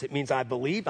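ordinary delivery

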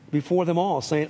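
ordinary talk, nothing marked

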